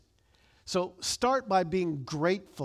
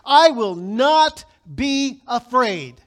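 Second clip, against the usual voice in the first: slow, much louder and higher; bold proclamation; 6 semitones higher, 13 dB louder, much slower than usual. Shown as a demonstration. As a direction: declare it fearlessly to the room. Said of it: "say it like you mean it".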